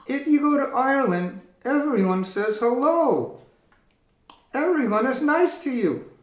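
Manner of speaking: high squeaky puppet reply. Acted. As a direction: voice the little puppet. Puppet voice explains.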